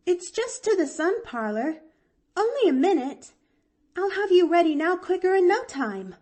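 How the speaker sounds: high, breathless and eager, tugging her aunt along; breathless panting. A narrator's reading. Girl panting as she drags her aunt to the sun parlor, high and breathless. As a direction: pant it out while pulling someone. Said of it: "panted Pollyanna".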